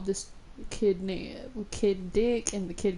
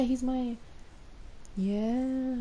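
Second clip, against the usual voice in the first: usual speaking voice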